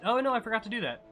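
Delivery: loud, high burst of dismay; sudden dismayed realization. Real, not acted. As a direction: blurt it as you suddenly remember. Remembers a forgotten viewer suggestion.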